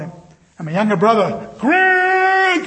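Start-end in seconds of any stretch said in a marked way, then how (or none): 1.6-2.7 loud high shout of a name; calling out. Retold or imitated. Younger brother shouting for his big brother on the beach. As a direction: yell across the beach to find someone.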